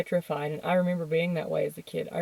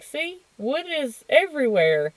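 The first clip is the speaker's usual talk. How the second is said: patronizing teacher; 8 semitones higher than usual. Retold or imitated. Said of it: "And she's like".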